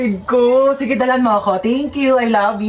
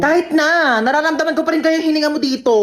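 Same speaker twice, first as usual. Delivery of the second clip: ordinary talk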